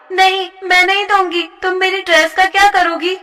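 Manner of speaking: refuse, scared for your dress; desperate refusal. Acted. A girl refusing her bully, desperate.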